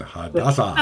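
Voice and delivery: Hadassah in a mock Japanese accent, laughing. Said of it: "I have to say it in, in a Japanese accent".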